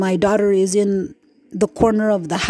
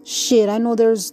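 ordinary talk, nothing marked

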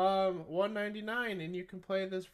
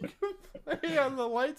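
talking through high-pitched laughter; laughing; 9 semitones higher, 5 dB louder than usual. Real, not acted. Podcast host cracking up mid-promo, laughing. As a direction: laugh your way into the line.

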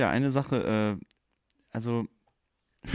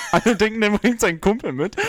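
high, laughing delivery; 8 semitones higher, 4 dB louder than usual. Real, not acted. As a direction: push the punchline out through laughter. Tells own joke while laughing. (lachend, mit hoher Stimme)

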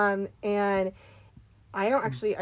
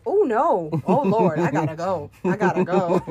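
loud, urgent "oh Lord"; panicked exclaim; 8 dB louder than usual. Retold or imitated. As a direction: blurt it out in mock alarm. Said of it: "and I go".

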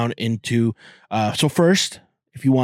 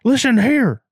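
much higher, louder hammed-up Southern accent; mock Southern threat; 11 semitones higher, 5 dB louder than usual. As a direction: square up like an angry Texan customer. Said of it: "drive back and be like".

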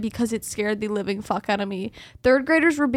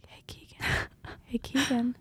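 eerie whisper; 8 dB quieter than usual. Retold or imitated. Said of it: "And I heard".

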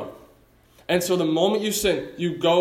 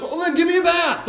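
much higher, louder eager Peter; over-eager Peter; 11 semitones higher, 3 dB louder than usual. Retold or imitated. Preacher voicing Peter begging Jesus for a bath, eager high outburst. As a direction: blurt it out like overexcited Peter. Peter jumping to other extreme.

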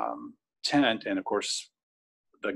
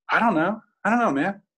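high, louder imitation of a confused friend; bewildered reply; 11 semitones higher, 5 dB louder than usual. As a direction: act out not knowing, voice swinging up high. Retelling sick friend's bewildered reply.

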